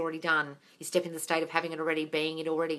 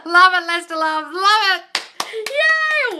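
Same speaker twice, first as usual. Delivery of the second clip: delighted squeal; 13 semitones higher, 14 dB louder, much slower than usual. Real, not acted. Delighted by a fan comment.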